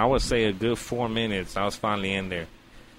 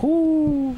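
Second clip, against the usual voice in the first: long, high, loud "whoo"; relieved whoop; 7 dB louder than usual. Retold or imitated. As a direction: blow out a big high whoop. Retold relief after pulling out.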